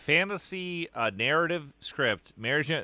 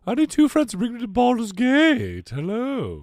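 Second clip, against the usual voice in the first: higher, louder questing hero; Percival character voice; 9 semitones higher, 6 dB louder than usual. Acted. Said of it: "It's me, Percival".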